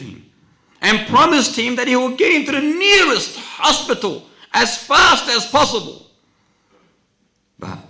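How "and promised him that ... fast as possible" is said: loud, high-pitched dramatic narration; urgent preaching. Real, not acted. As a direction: hurl out the story at full force.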